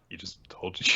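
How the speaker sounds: hushed, whispery retort; mock exasperated whisper. Doubt answering Mash's obvious question, mock exasperated whisper. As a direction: whisper it as a put-on comeback.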